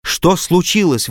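the doctor crying out to the kneeling man; cried out. A narrator's reading. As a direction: blurt it out in alarm. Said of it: "выкрикнул я".

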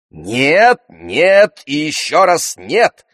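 high, loud, drawn-out refusal; emphatic refusal. A narrator's reading. Mother refuses to allow revenge, emphatically.

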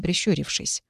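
ordinary delivery

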